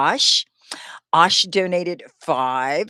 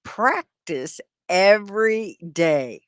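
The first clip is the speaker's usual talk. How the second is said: slow, evenly spaced words; deliberate teacher's model for repeating; slow repeat-after-me; 4 dB louder, slower than usual. Shown as a demonstration. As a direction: lead the class slowly through it. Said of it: "Can you say that with me?".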